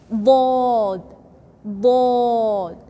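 "bod" strong, tongue on the teeth; emphatic bod. Shown as a demonstration. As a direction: say "bod" heavy and full. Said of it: "is strong harf".

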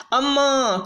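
high drawn-out begging cry; a hint of a wailing beggar; beggar's wail. A narrator's reading. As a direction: wail it from the gate. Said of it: "came a wail from the gate".

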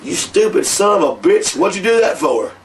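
imitating Ole Anderson's mock fury; outraged yell. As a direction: tear into him for the stupid stunt. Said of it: "I heard Anderson going".

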